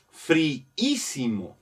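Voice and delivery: "friísimo" slowly as fri-ísimo, both i's sounded; drawn-out double i. Shown as a demonstration. Teacher making the word long for students, drawn-out double i. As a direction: stretch "friísimo" so the ísimo stands apart. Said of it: "para hacerla larga".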